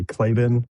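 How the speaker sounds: "Klabin" as KLAY-bin; English and American pronunciation. Shown as a demonstration. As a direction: stress the first syllable of "Klabin". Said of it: "usually in England and in America".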